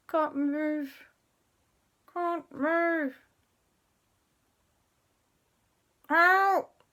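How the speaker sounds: high, strained plea as if paralysed; frozen stiff. Acted. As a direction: squeeze the words out without moving. Story character suddenly frozen, calls help.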